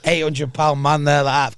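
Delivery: ordinary talk, nothing marked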